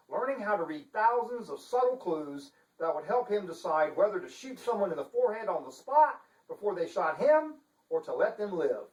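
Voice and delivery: usual speaking voice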